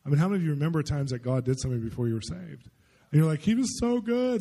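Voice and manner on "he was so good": high, drawn-out gushing quote; stretched, high-pitched praise; retold marvelling. Voices listeners fondly remembering God.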